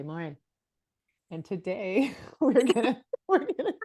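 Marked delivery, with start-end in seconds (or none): 1.4-3.7 laughing through the words; giggling while trying to start. Real, not acted. Hosts laughing at blank start.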